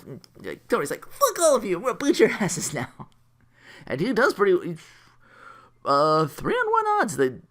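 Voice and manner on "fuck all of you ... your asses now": high-pitched hammed-up kid's threat; mock angry threat. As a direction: threaten them like a riled-up kid. Acted retort in a kids' quarrel.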